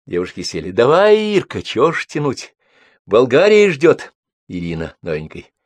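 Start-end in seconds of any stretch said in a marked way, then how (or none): 0.7-4.1 high, loud, eager send-off; brisk urging. A narrator's reading. Girls see Irina off to Bulgaria, urging. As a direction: chivvy a friend along, voice lifted high.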